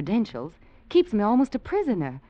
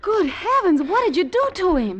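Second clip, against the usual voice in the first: shocked; 12 semitones higher, 5 dB louder than usual. Acted. Shocked at knocked-out general.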